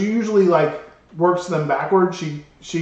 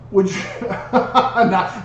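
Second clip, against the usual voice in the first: laughing; 2 semitones higher, much slower than usual. Real, not acted. Laughing mid joke.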